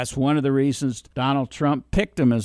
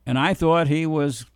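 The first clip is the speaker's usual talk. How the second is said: usual speaking voice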